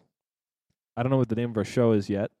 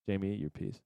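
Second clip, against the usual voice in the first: put-on parent to child; 3 semitones lower, 10 dB quieter than usual. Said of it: "a small child".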